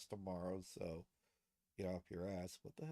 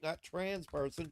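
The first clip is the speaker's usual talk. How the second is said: usual speaking voice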